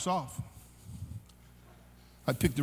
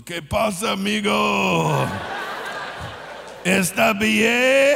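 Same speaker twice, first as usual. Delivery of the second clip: slow, drawn-out Mexican Spanish; 2 semitones higher, much slower than usual. Retold or imitated. Stable owner asks fallen rider in Mexican Spanish if okay. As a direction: stretch out the Mexican Spanish words. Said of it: "the Mexican man ... goes".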